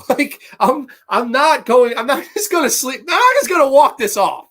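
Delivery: high, loud, laughing rant; hammed-up indignant yell breaking into laughs; mock outrage, laughing. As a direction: shout it through laughter, voice way up. Acts her outrage, laughing.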